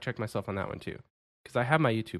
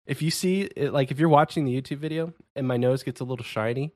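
ordinary talk, nothing marked

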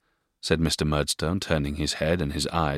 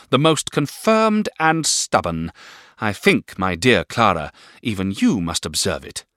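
higher, louder sour spinster; Miss Murdstone voice; 5 semitones higher, 5 dB louder than usual. A narrator's reading. Miss Murdstone condemns the boy, higher and sour. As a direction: spit it out with contempt. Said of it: "remarked his sister".

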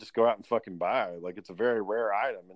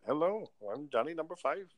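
mock Indian accent; 4 semitones higher, 4 dB quieter than usual. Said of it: "he's talking all stupid like that".

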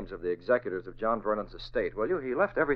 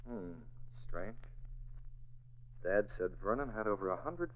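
softly, to himself, puzzling it out; half-voiced musing alone in the office; quiet thinking aloud; 2 semitones lower, 13 dB quieter than usual. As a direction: murmur the thought under the breath. Thinking aloud alone after call.

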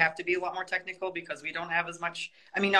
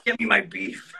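demanding bro voice; 5 semitones higher, 4 dB louder than usual. Pretend. Mocking demanding gym bro.

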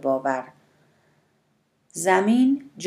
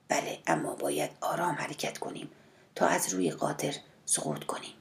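hushed caution; 2 semitones lower, 11 dB quieter than usual. A narrator's reading. Son-in-law cautioning against falling off the mule, hushed. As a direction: warn softly and carefully.

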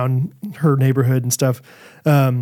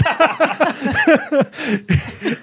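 long high laugh; a long, high burst of real laughter; 12 semitones higher than usual. Real, not acted. Laughs at daughter's refusal.

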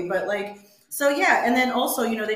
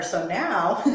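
ordinary talk, nothing marked

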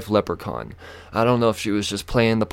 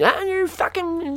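high-pitched garbled rant; mock going off; slower than usual. Officer going off at the driver, mock high-pitched rant. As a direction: imitate her ranting on, high and squawky. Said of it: "just going off".

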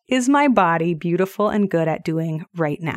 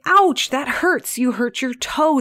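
higher, slow sympathetic voice for a little one; soothing toddler talk; 6 semitones higher, slower than usual. Retold or imitated. Mother soothing her toddler who stubbed his toe, higher and slow. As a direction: coo over a stubbed toe. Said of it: "I say".

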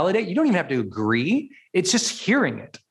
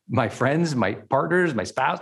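usual speaking voice

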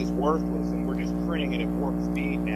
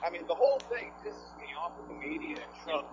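normal talking voice

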